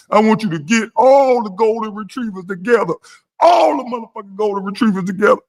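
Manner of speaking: high, hammed-up crying voice; mock crying. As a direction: blubber out orders like a hurt child. Acted caller crying to goons.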